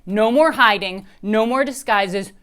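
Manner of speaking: loud, determined yell; girl declaring war to her doll, loud yell. Acted. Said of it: "I yell".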